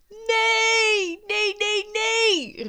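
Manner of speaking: very high, loud wail of protest; imitating a teacher shrieking in panic; retold desperate cry. Teacher sees pupils jumping out the window, shrieking. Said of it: "riep mevrouw Vogel weer".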